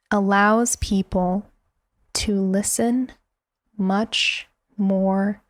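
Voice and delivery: very slow, with long pauses between phrases; going slow. Shown as a demonstration. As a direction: parcel it out a few words at a time, leaving silence. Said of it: "I just did it".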